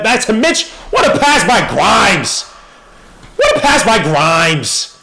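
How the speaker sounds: high, loud, slow hype over the pass; real excited cheer at the play; excited shout. Real, not acted. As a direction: yell it out in pure hype. Hyped reaction to a great pass.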